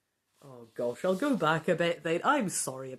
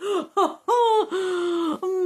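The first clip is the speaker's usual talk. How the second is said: squealing laugh; a high goofy cackle mid-line; giggling laugh in a daft voice; silly high laugh; 12 semitones higher, 8 dB louder than usual. Acted. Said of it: "the really stupid voice".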